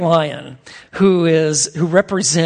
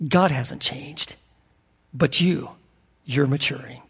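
intimate preaching; 2 semitones lower than usual. Acted. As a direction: lean in and speak it gently to the church. Preacher applies story softly.

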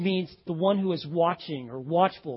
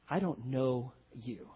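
solemn soft; 8 semitones lower, 10 dB quieter, slower than usual. Acted. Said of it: "as Jesus says in the parable".

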